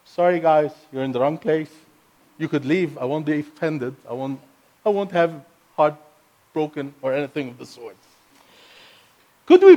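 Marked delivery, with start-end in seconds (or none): none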